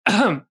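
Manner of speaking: small ahem to get attention; mock polite ahem; polite throat clear. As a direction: clear the throat politely, not a sick cough. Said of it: "ahem kind of cough".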